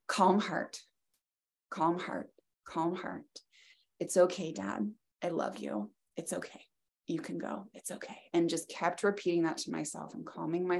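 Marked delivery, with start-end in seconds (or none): 0.1-8.2 hushed, fading to a whisper; calm him gently, barely voiced; soft soothing. Real, not acted. Daughter softly soothing her dying dad, fading to a whisper.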